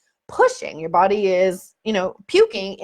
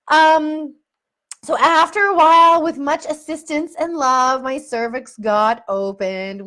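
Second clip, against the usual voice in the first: normal talking voice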